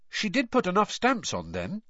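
put-on high-pitched boy, dropping on the last word; casually excusing boy. A narrator's reading. Harry excusing Missus Weasley's mistake, trying to sound casual. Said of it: "trying to sound as though Missus Weasley's was a mistake anyone could make".

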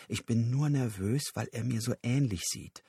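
ordinary delivery, nothing marked

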